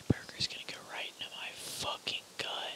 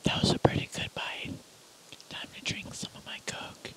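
whispering. Real, not acted. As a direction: whisper close to the mic. ASMR eating video.

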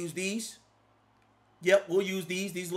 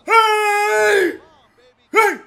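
loud, higher celebratory yell; hype shout; 10 semitones higher, 10 dB louder than usual. Real, not acted. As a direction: shout in triumph.

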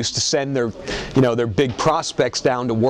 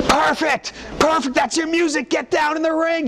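urgent yelling agent. Retold or imitated. Agent calling Perfect to the ring, urgent yell. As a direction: yell at him to get moving.

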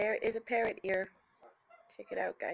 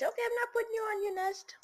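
high reassuring; 11 semitones higher, faster than usual. Real, not acted. Reassuring the bird.

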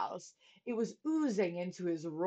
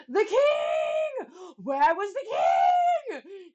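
high, loud cry of alarm; alarmed gasp; 11 semitones higher, 9 dB louder, much slower than usual. A narrator's reading. Bartholomew remembering the forgotten king, alarmed shout. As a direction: shout it in sudden horror, drawing out the end. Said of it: "Bartholomew gasped".

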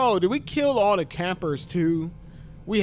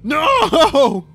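loud high no into a wail; despairing scream; 6 semitones higher, 8 dB louder than usual. Real, not acted. Gamer screaming as his character dies, despairing. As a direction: scream at the screen. Death in game.

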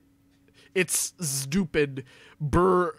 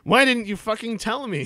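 higher, louder hammed-up yell; mock shout; 8 semitones higher, 5 dB louder than usual. Reading Draco's line to friends, mock shout. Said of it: "he shouted".

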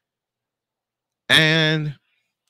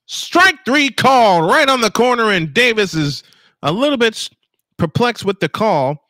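excited call; 11 semitones higher, 6 dB louder than usual. Real, not acted. Called third strike, big moment.

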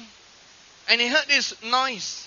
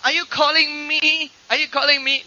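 unsure call back; 6 semitones higher, 5 dB louder than usual. Retold or imitated. Acting out someone unsure of call.

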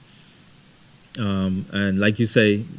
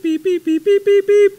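high mock keypad beeps, getting louder; imitated phone beeps; 4 dB louder than usual. Preacher recalling courting on the home phone, high imitated beeps. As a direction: beep it out like an old phone pad.

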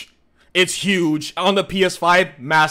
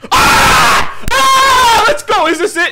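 excited shouting; 12 semitones higher, 5 dB louder, slower than usual. Real, not acted. Reacting to a game reveal.

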